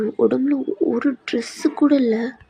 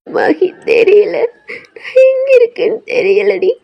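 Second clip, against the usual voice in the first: very high, tearful and slow; sobbing; 10 semitones higher, 5 dB louder, slower than usual. Acted. Girl tells friend she doesn't know where she is, sobbing. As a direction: cry it helplessly.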